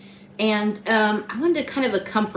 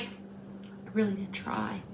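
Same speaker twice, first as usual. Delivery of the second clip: whispered aside; 13 dB quieter, slower than usual. Real, not acted. Weary aside after week's story.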